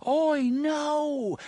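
faint far-off warning scream; distant scream. A narrator's reading. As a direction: cry out high, as if heard through doors. Same scream heard faintly through doors.

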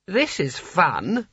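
slow, high, lightly put-on voice; Creosote voice. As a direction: savour it slowly like a dreamy sultan. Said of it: "said Creosote".

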